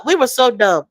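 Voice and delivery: ordinary talk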